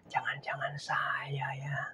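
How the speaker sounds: breathy unvoiced whisper. Pastor voicing a doubting believer, whispered. Self-reflection whispered aside.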